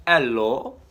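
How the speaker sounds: a quick failed try at a Russian Hello; a badly faked Russian accent. Shown as a demonstration. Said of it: "I can't do a Russian accent".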